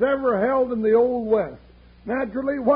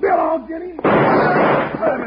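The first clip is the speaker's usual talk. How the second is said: shout; 6 semitones higher, 7 dB louder than usual. Acted. Grabbing the villain, calling out.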